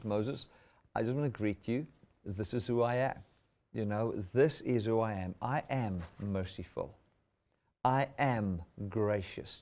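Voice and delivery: soft, tender words of God to Moses; gentle voice of God. Acted. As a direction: speak softly, like a gentle invitation. Preacher voices God greeting Moses.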